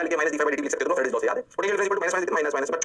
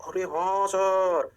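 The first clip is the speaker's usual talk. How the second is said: long, slow sustained "yes sir"; put-on chorus of realization; mock student agreement; 2 semitones higher, much slower than usual. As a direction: draw out every vowel in agreement. Acts out class's drawn-out agreement.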